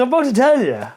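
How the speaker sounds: high-pitched acted protest; imitated exasperated comeback; mock protesting reply. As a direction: protest it back, pitched well up.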